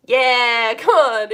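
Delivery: loud, cheering yeah; mock hype. Teacher hyping up the glory of stratus, loud mock cheer. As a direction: whoop it up for a dull cloud.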